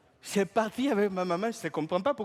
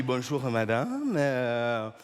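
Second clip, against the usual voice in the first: gallant greeting; 8 semitones lower than usual. Retold or imitated. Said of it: "J'ai dit".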